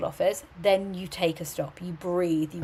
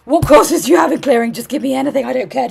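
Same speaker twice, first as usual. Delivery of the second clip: much higher and louder, frantic begging; loud, high mock panic on the phone; panicked caller; 5 semitones higher, 13 dB louder, faster than usual. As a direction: plead like a desperate student grabbing any course. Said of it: "ringing up in a complete panic".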